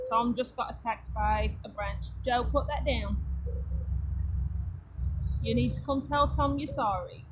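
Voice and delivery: calmly sort out the squabble without raising your voice; quiet mom voice. Real, not acted. Mom quietly directing kids mid-vlog.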